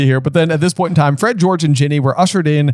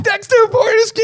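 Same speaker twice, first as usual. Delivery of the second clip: excited. Real, not acted. Recognizes the portrait's name.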